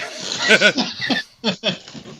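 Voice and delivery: high, loud burst of laughter. Real, not acted. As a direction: laugh out freely. Laughter after joke.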